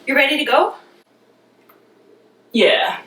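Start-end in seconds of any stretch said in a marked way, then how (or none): 0.0-0.8 lightly voiced, higher and teasing; calling the con artist over, teasing; mock reenactment. Herself calling to the con artist boyfriend in a teasing reenactment. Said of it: "I am going to reenact this for you".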